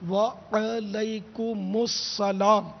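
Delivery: very slow وعلیکم with a long open wa-a and the ain with zabar; correct pronunciation. Shown as a demonstration. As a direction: model وعلیکم السلام syllable by syllable. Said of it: "درست اس کا تلفظ ہے".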